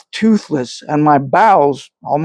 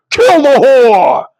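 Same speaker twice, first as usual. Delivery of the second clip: higher, louder, slow savage shout; vicious cry; 9 semitones higher, 5 dB louder, slower than usual. A narrator's reading. A horseman calls for the witch's death, shouting. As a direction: bellow it for blood.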